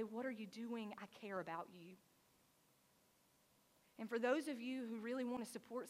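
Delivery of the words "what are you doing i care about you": soft, low, hushed voice; a tender, softer reassurance; gentle whisper. Acted. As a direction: speak it gently, barely above a whisper. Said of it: "hear God say".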